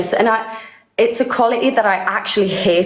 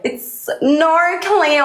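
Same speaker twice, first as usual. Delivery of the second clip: higher, drawn-out Australian vowels; exaggerated Aussie accent; 7 semitones higher, much slower than usual. Guest jokes about the host's Aussie attempt, exaggerated Aussie accent. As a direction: stretch it out in broad Australian. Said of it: "Is the Aussie accent really, like, hitting".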